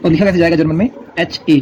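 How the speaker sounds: ordinary talk, nothing marked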